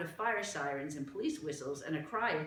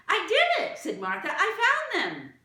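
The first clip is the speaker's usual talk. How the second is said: triumphant shout; 12 dB louder than usual. Acted. Martha proudly announces she found the others, loud. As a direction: shout it like a child who just won.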